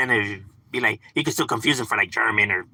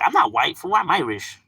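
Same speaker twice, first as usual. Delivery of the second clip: high-pitched mock protest, settling at the end; imitated indignation; 7 semitones higher than usual. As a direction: play the offended friend correcting people. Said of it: "he does get mad".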